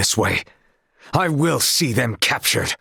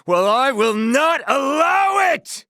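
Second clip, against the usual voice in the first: spellbound shout; slower than usual. Acted. Bewitched knight forbidding the barrel smashing, high shout. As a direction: forbid it with a shrieking cry. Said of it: "Was I under some spell?".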